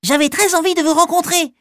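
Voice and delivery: high, fast squeaky mouse; little mouse voice. Acted. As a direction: chatter it like a tiny mouse. Mouse explains warmly.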